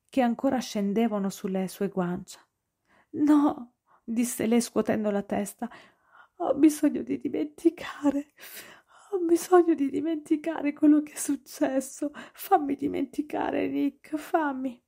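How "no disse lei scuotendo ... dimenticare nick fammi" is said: high, desperate, tear-soaked voice; tearful pleading. A narrator's reading. Noah begging Nick to make her forget, tearful. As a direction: beg him through tears.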